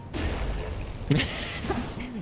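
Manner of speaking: breathy voiceless chuckle; laugh. Real, not acted. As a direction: chuckle softly, almost without voice. Amused at Leviathan play.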